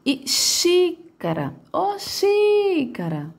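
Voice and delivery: "xícara" called with XÍ high and very long; slow, high calling tone, XÍ stretched in "ô xícara"; dragged stressed syllable. Shown as a demonstration. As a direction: drag out the first syllable of "xícara" like a call. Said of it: "a sílaba que mais se arrasta".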